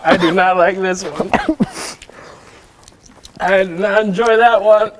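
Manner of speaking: high, loud distressed protest; loud pained complaint. Real, not acted. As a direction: wail it through the burn. Burning from hot sauce.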